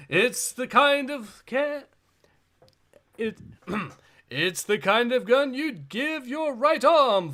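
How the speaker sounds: lightly voiced arena announcer. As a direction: present the fighters like a show host. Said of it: "I'll do the voice of the announcer here".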